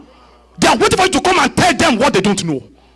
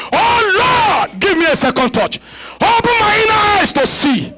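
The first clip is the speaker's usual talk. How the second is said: crying out to God; 9 semitones higher than usual. Acted. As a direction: lift the plea with full force. Loud climax of prayer.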